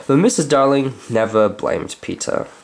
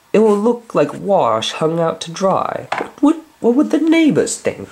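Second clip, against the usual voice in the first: fretful mother; 8 semitones higher than usual. Acted. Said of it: "Miss Darling said".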